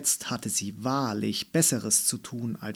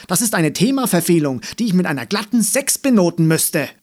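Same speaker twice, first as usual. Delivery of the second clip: higher, louder and fast; heated raised-voice rebuttal; calling out sharply; 6 semitones higher, 11 dB louder, faster than usual. A narrator's reading. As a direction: fire it back loud and quick. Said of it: "rief Frau Löbner".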